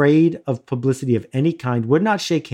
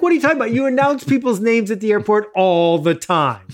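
high, indignant disbelief at the gate agent; pitched-up mock outrage; incredulous retort; 7 semitones higher than usual. Arguing with the gate agent, high incredulous outrage. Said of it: "I'm like".

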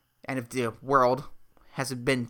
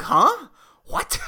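baffled reaction; 13 semitones higher, 5 dB louder than usual. Retold or imitated. Acted reaction to unexpected talking.

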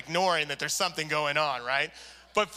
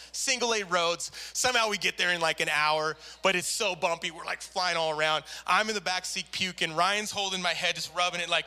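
usual speaking voice

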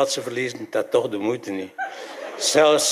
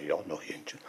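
softly agreeing to one more; quiet yes; 5 semitones lower, 14 dB quieter than usual. Retold or imitated. Teller quietly answering the deaf man, much softer. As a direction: murmur it low, almost to yourself. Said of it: "Ik zei".

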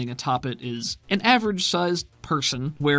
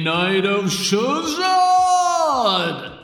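high, loud, booming arena hype; rally the whole stadium; fighting game announcer; 5 semitones higher, 6 dB louder, slower than usual. Acted. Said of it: "The announcer voice comes back on".

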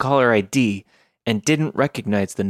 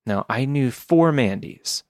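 ordinary delivery, nothing marked

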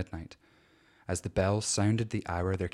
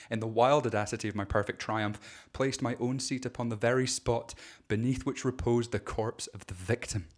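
plain reading voice

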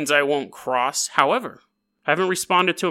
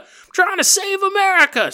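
much higher, louder repeat of America; hammed-up cry; 4 dB louder than usual. Arrested man crying out to cops, hammed-up. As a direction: shout it out like a wild-eyed patriot. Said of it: "he's like".

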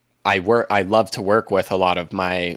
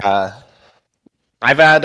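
normal talking voice

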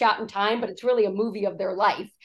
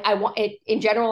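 usual speaking voice

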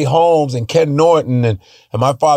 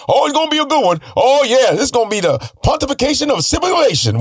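much higher, hammed-up promoter hype; Don King impression; 11 semitones higher, faster than usual. Promoter hyping the Zaire fight, high and grandiose. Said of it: "Don King's like".

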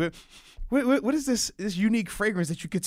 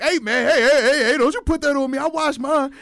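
loud, higher, protesting; mock defensive outburst; 5 semitones higher, 7 dB louder than usual. Mocking co-host's defence, loud protest. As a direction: insist loudly that you wash. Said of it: "That boy Wes came on quick".

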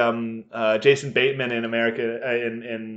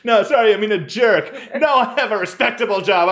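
flustered correction; 7 semitones higher, 4 dB louder, faster than usual. Acted. Acted dad protesting on call.

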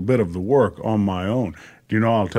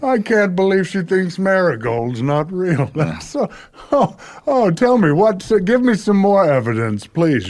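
amused disbelief; 7 semitones higher than usual. Acted. Santa amused by child's claim.

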